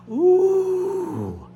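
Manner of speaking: high, drawn-out "ooh"; relieved gator ooh. Acted. Gators cooling off in the swamp, high drawn-out ooh. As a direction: let out a long high coo. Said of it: "And then those gators all said".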